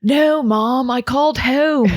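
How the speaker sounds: slow, drawn-out Delco vowels; Delco accent. Retold or imitated. Host mimicking a TV drama daughter, Delco accent. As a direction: put on an exaggerated Delco accent, slowly. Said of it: "that accent she does".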